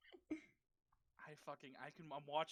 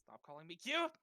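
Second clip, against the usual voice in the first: flustered protest; 6 dB louder than usual. Real, not acted. Flustered protest at being called cute.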